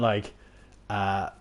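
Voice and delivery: normal talking voice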